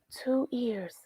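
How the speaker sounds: hushed whisper of discovery; breathless little whisper. A narrator's reading. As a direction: whisper it like a secret. Peeking into glove box.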